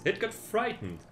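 ordinary delivery, nothing marked

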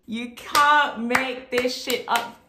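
very slow, each word spaced; slow emphatic. Real, not acted. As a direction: drag it out for emphasis. Repeated slowly for effect.

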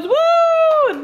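high, loud whoop; excited cheer. Real, not acted. Cheering over tacos.

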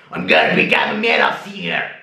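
low fast shouted growl; metal growl. Shown as a demonstration. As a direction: bark it like a metal singer. Metal singer take after squeaky one.

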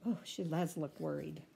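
ordinary delivery, nothing marked

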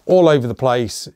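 ordinary talk, nothing marked

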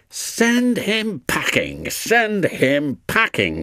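high, slow, scornful snort of words. A narrator's reading. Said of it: "snorted Duncan".

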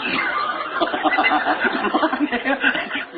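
high, unvoiced wheezing laugh; breathless laugh. Real, not acted. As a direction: laugh silently until you wheeze. Laughing at the memory.

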